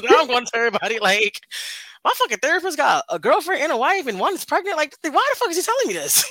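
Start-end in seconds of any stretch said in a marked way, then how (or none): 2.0-6.2 high, exasperated retelling; mock outraged rant. Telling everybody about the therapist, high and exasperated. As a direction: vent it in disbelief.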